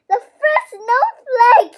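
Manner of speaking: high, loud, tearful joy; relieved almost to tears; joyful relief. A narrator's reading. Max sees first snowflake.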